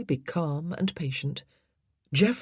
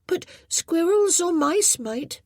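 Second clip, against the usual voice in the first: put-on high, slow little boy voice; a small boy thinking aloud; young boy voice; 13 semitones higher, 3 dB louder than usual. A narrator's reading. Boy character voiced in story.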